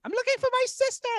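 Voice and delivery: put-on very high-pitched London girl accent; London accent impression. Lost girl to stranger at a club, high London accent. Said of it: "This is exactly how she sounded".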